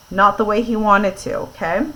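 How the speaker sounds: ordinary delivery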